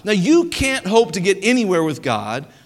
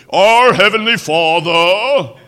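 mock holy voice, slow and much louder; solemn pulpit prayer parody, stretched out; pious prayer voice; 8 dB louder, slower than usual. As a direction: ham up a churchy prayer voice. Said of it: "whose voice completely changes when they go to pray".

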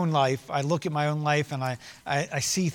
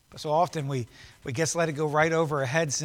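ordinary talk, nothing marked

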